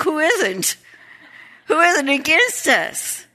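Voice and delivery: high-pitched exasperated protest; mock exasperation. We think everyone is against us, mock exasperation. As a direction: complain as if everyone is against you. Voices our exasperated thought.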